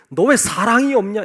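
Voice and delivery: a hammed-up stern rebuke; rebuking. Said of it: "이렇게 책망한 적 없어요". (꾸짖는 큰 목소리)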